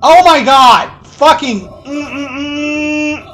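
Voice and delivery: loud, slow, strained frustration; frustrated outburst. Real, not acted. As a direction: yell at the screen in frustration. Player dying in hard sequence.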